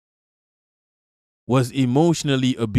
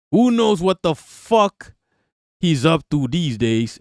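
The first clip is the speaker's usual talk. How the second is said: ordinary delivery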